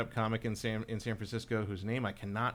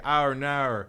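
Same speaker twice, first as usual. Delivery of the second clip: mock Aussie "Our Naur", a bit louder; Australian accent; 2 semitones higher, 6 dB louder than usual. Film crew groaning at trouble, in an Australian accent. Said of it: "everyone's like".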